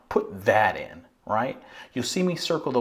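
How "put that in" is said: "put that in" punched on THAT, falling at the end; declarative, going down. Shown as a demonstration. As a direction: declare "put that in" and let the pitch drop. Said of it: "declarative and to go down".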